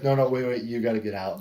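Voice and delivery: loud, urgent wait wait; urgent shouting. Retold or imitated. Wrestler telling fan to leave the photo, loud urgent. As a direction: wave the guy out of the picture, loudly. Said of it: "He's like".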